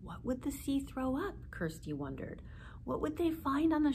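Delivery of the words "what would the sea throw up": ordinary delivery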